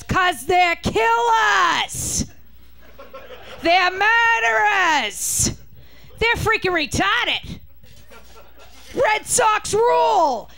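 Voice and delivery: high, slow, shouted Boston fisherman rant; Boston accent, shouting, drawn out; thick Boston accent. Retold or imitated. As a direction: bellow it like a rough Boston dockhand. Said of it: "he sounds like he got off the Swordfish boat".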